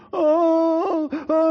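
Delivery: high, loud pained wails; crying out loud. Retold or imitated. Boy wails at thawing hands, high and loud. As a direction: wail like thawing hands hurt. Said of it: "I'm crying out loud".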